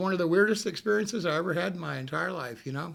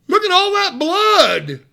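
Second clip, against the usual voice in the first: slow, much higher and louder; horrified clerk; 10 semitones higher, 10 dB louder, slower than usual. Retold or imitated. Hotel clerk pointing at the blood-soaked shirt, horrified. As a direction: point it out in horror. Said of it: "He said".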